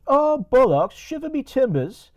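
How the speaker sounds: high, loud mock-English exclamation; hammed-up squeaky caricature of an Englishman; Creepy English. Creepy English getting pulled over by police, high mock voice. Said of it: "And Creepy English says".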